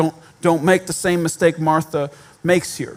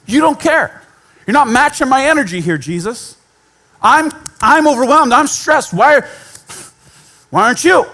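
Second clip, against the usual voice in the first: higher, louder, stressed-out complaint; frantic Martha; 5 semitones higher, 4 dB louder than usual. Acted. Martha complaining to Jesus, higher and louder, frantic. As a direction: voice an overwhelmed, accusing Martha. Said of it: "her instinct was to go".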